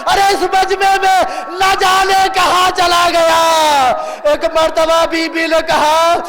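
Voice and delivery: drawn-out, sustained mourning narration; full-force majlis oration with stretched endings; impassioned lament preaching. Real, not acted. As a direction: chant the tragedy out to the crowd.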